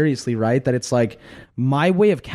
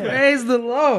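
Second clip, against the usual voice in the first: high, slow, laughing praise; drawn out like a church shout; gospel preacher; 11 semitones higher, much slower than usual. Retold or imitated. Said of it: "in a gospel choir or something".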